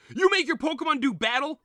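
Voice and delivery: high, loud appalled question; shocked outrage. Acted. Chairman shocked that Red battles, appalled outburst. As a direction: gasp it out in disbelief.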